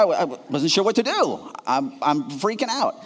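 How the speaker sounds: ordinary talk, nothing marked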